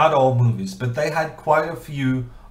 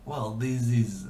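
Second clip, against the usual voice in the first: hesitant try at a French accent; attempted French accent; 4 dB quieter than usual. Shown as a demonstration. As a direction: slowly feel your way into a French accent. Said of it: "try to do a French accent".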